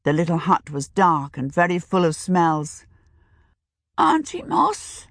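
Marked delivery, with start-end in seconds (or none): 4.0-5.1 her voice for these people. A narrator's reading. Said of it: "in the voice she had for these people".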